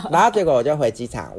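normal talking voice

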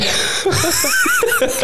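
very high cackling burst of laughter; squealing laugh. Real, not acted. Host cracking up at a lame joke, squealing laugh.

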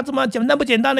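high, pressing question rising at the end; anxious parent questioning. Retold or imitated. Parent quizzing a child just out of the exam, high and pressing. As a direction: push the question like a nervous parent. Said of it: "这种连珠炮式的那种关心".

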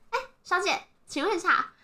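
politely high-pitched old-lady imitation; too-young old lady. Retelling a lady approaching her about the car, high polite imitation. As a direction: put on a high, youthful voice for the lady. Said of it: "他的声音应该没有那么年轻".

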